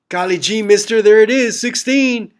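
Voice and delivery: put-on high, eager golly-gee kid voice; wholesome 1950s kid. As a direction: gush it like a naive kid. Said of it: "Don't just be like".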